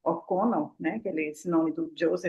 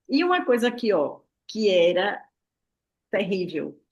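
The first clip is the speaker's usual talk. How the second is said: ordinary talk, nothing marked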